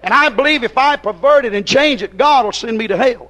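normal talking voice

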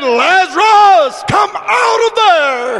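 long, high preacher's shout; commanding call. Retold or imitated. Preacher voicing Jesus calling Lazarus from the tomb, commanding shout. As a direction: call out to the dead, high and drawn out.

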